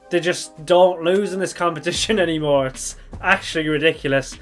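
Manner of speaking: usual speaking voice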